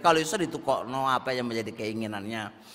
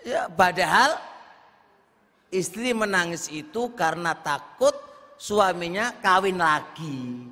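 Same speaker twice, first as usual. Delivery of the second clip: ordinary delivery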